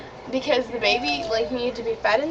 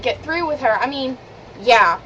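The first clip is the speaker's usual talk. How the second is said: ordinary delivery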